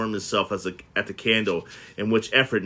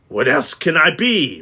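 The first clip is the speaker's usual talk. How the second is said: grumpy retort; 5 semitones higher, 6 dB louder than usual. Acted. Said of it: "returned the uncle".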